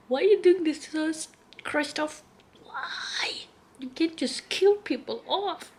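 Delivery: high, pleading protest to the author; a half-joking cry of despair; mock anguished plea. Real, not acted. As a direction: beg the writer to stop.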